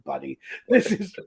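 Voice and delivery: normal talking voice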